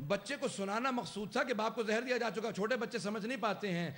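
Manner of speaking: high, loud, drawn-out mourning cry; full-force lament. Acted. Preacher crying out a dying husband's last wish, full-force lament. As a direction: build to a slow wailing shout.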